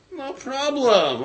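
high, drawn-out, carefree offer; retold easy generosity, stretched and lilting; breezy assurance. Katriel offers the brother four hundred coins, breezily.

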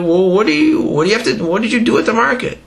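high, quick questioning voice; lightly voiced townsfolk; curious villagers.

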